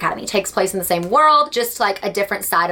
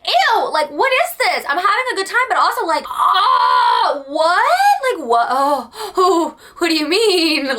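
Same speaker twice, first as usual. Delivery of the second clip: much higher, half crying, half shouting; hysterical mock shock; 8 semitones higher, slower than usual. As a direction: shriek in overwhelmed disbelief. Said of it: "I was like".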